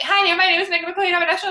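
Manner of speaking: high, rattled-off mock rehearsal read; flustered script reading. Rushed, stressed autocue read-through re-enacted.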